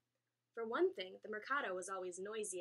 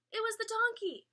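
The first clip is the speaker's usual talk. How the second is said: excited discovery; 12 semitones higher, 9 dB louder than usual. A narrator's reading. Delighted surprise at piñata.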